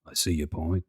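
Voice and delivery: low, quiet, unruffled reply; mock-calm British murmur; chill boyfriend. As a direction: play him utterly unbothered, very soft. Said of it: "they're both so chill".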